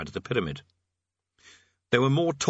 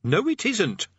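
high, irritable retort; snapped; 11 semitones higher, slower than usual. A narrator's reading. Rincewind contradicting the parrot, snapping. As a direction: snap back at once. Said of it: "snapped Rincewind".

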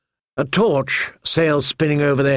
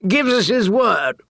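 slow, higher mocking tone; mocking knight; 10 semitones higher, much slower than usual. A narrator's reading. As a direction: sneer at the old man. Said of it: "The knight in the spike helm laughed".

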